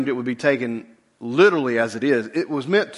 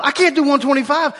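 high, whiny complaint; whiny lifter; 11 semitones higher, 3 dB louder than usual. Retold or imitated. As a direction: whine like a beaten lifter. Lifter complaining about the weight.

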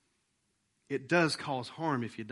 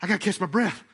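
winded player; 3 semitones higher, 4 dB louder than usual. Retold or imitated. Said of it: "what are they doing".

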